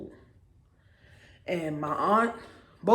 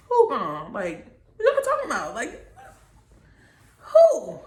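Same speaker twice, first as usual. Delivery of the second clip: disbelief; 4 semitones higher, 4 dB louder than usual. Retold or imitated. Told mom has died.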